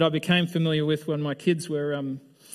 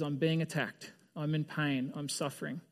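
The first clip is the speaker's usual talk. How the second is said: quiet plea; 9 dB quieter than usual. Retold or imitated. Paul's plea voiced.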